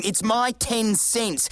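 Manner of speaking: ordinary delivery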